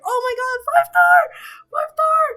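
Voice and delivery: high, loud shrieks easing into cries; thrilled high-pitched screaming, gradually coming down; excited screaming. Retold or imitated. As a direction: freak out over an incredible lucky pull. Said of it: "It's just me screaming half the time".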